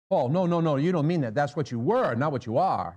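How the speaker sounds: high, loud incredulous no, no, no; mock objector pleading with Paul; protesting objector. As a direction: argue back at Paul in disbelief. Imagined objector arguing with Paul.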